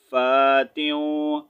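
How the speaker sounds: ordinary delivery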